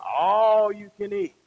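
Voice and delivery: long, loud "All", then the rest; relished ALL. Acted. Diner justifying himself at the buffet, drawn-out "All". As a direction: stretch "All" out emphatically.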